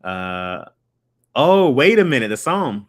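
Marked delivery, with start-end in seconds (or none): 1.3-2.9 loud, high surprised "oh, wait a minute"; sudden realisation. Real, not acted.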